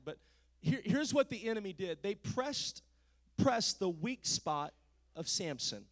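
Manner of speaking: ordinary delivery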